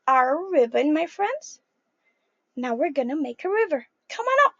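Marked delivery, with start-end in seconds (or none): none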